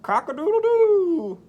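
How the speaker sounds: very high, loud crowing; rooster crow. Acted. Voicing the rooster for the kids, loud high crow. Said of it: "crowed the rooster".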